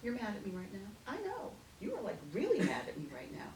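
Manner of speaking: calmly humoring an upset niece; aunt gently brushing off her niece's anger; mock calm aunt. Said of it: "I'd be like".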